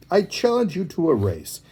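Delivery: high, eager challenge; excited hare voice; blurting. A narrator's reading. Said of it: "blurted the hare".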